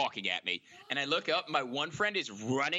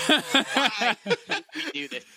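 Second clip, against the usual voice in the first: loud, drawn-out mock wail; high, hammed-up crying lament; hysterical regret; 10 semitones higher, 8 dB louder, slower than usual. Kid regretting the play-dead prank, hysterically crying. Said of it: "hysterically crying".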